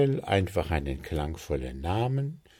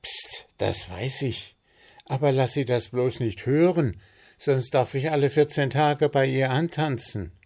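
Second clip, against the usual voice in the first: higher, louder, self-important gnome voice; Puh the gnome; 7 semitones higher, 4 dB louder than usual. Acted. Puh tells friends about the blocked drain, high and loud. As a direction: boast about his plan, pitched up.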